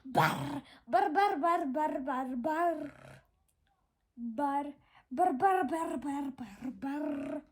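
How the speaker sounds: loud rolling barr birdcall; different bird voice. A narrator's reading. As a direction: growl the barr like a strange bird. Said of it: "in a different voice".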